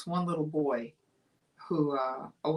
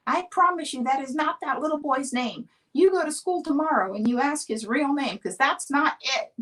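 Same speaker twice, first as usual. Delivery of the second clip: much higher, louder firm mother; setting the record straight from the kitchen; mom scolding; 10 semitones higher, 6 dB louder than usual. Retold or imitated. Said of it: "She goes".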